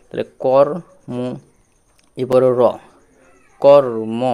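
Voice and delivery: কর and মো said loud and separate; emphatic syllables. Shown as a demonstration. Teacher drilling pupils on syllables, loud and emphatic. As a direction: punch out each syllable.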